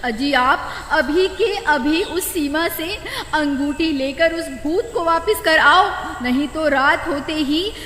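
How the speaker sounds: high woman's voice, alarmed; worried wife. Acted. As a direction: urge her husband to return the ring. Wife character voice.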